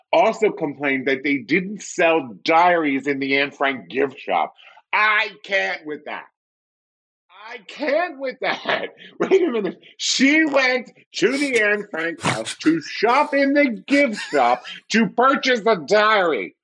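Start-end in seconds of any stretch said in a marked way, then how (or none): 4.9-9.8 exaggerated disbelief. Comedian reacting to an absurd gift shop complaint, exasperated disbelief. 10.0-16.5 very high, slow, stressed disbelief; comic incredulity. Acted. Comedian recounting the Anne Frank house complaint, high incredulity.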